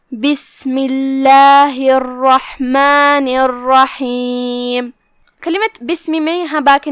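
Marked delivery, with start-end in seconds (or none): 0.1-4.9 basmala chanted very slowly; solemn tajweed recitation; slow Quran recitation. Shown as a demonstration. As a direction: draw out every vowel of the basmala. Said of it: "تو شروع کرتے ہیں۔".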